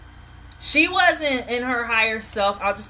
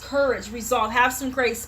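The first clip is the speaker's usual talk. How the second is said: normal talking voice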